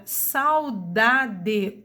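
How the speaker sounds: drawn-out saudade, each sound clear; teacher stretching saudade; slowed demo word. Shown as a demonstration. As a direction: say saudade slowly for the class. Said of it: "o A é a minha vogal".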